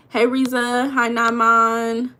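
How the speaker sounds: ordinary delivery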